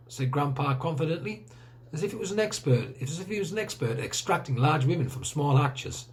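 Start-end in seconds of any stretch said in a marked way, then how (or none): none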